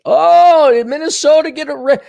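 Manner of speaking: high, loud, fake surprise; hammed-up oh-darn; mock dismay. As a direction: act shocked about Minnesota.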